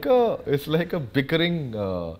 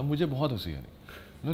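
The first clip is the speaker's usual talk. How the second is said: ordinary delivery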